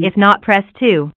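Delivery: ordinary talk, nothing marked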